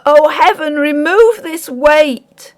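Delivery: high, very slow anguished plea. Acted. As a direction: beg heaven in agony. Miller praying in anguish.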